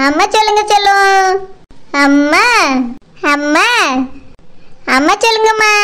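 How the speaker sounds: low, tender coo with rising endings; cooing baby talk. Acted. Mother teaching her little child to say amma, cooing. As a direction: coax a baby to copy you.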